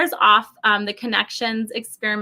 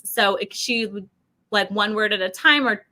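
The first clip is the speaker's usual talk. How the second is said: usual speaking voice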